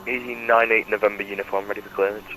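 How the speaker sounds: over a pilot headset. Real, not acted. Pilot calling in over radio.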